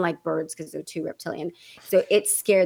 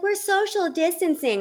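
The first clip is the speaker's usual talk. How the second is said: mock friend; 9 semitones higher than usual.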